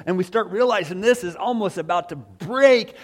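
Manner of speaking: normal talking voice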